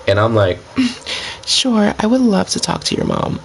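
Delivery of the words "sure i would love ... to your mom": high, put-on girl voice for trolling. Shown as a demonstration. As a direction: switch into a high girly troll voice. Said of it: "So I switched my voice immediately".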